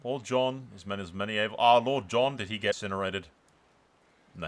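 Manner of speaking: normal talking voice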